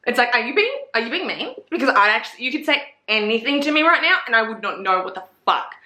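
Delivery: normal talking voice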